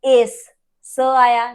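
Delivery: ordinary delivery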